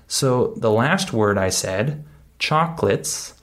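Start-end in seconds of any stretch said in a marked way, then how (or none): 2.4-3.3 "chocolates" as "chock-lits"; two syllables. Shown as a demonstration. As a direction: drop the middle vowel of "chocolates". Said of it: "it's just two syllables".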